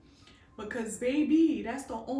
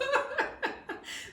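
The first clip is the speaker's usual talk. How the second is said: high unvoiced giggle; breathy laugh; 12 semitones higher than usual. Real, not acted. Laughing at her own story, a high breathy giggle. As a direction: let a whispery laugh out.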